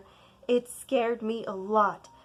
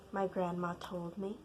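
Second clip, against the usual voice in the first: usual speaking voice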